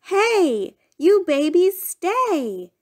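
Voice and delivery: high call, slowing on babies stay; hollering. Acted. Parent hollering after the crawling babies, high mock call. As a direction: call the babies back, stretched out. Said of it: "You hollered".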